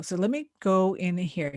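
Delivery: normal talking voice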